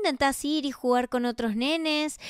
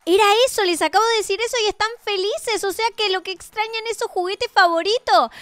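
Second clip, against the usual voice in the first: higher and louder, delighted; excited relief; 7 semitones higher, 4 dB louder than usual. Acted. Mother realizing the kids miss their toys, excitedly. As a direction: burst out with the happy discovery.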